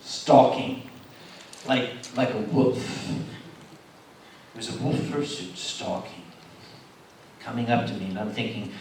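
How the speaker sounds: hushed, low stalking narration; suspenseful storytelling. Acted. Telling the crowd of a wolf fursuit stalking, hushed suspense. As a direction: draw it out low and ominous.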